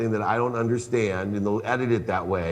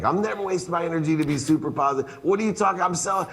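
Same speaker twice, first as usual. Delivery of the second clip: higher and faster, protesting eagerly; excited protest; 8 semitones higher, faster than usual. Retold or imitated. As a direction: insist fast and high that positivity is no waste. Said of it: "I'm like".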